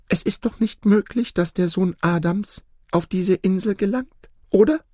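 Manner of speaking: very high, worried questioning; anxious goose. Acted. Goose asking the peacock if she is safe, anxious. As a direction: seek reassurance, ending on a sharp rising tag. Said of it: "fragte die Gans ängstlich".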